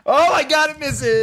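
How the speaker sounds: high, loud delighted shout; excited shout. Real, not acted. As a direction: yell it in disbelief and joy. Attack misses, player cheers.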